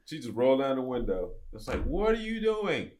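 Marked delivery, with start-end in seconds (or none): 1.8-2.9 very high, drawn-out mock question; amused mock disbelief; incredulous imitation. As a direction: imitate the amazed driver, stretched and pitched way up. Re-enacts driver's question through window.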